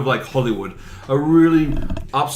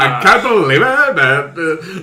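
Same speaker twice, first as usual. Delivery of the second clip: mock Jamie voice; imitating Jamie; 3 semitones higher, 5 dB louder than usual. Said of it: "That's how it sounds".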